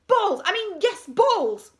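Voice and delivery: high, loud involuntary outbursts; flustered blurting. A narrator's reading.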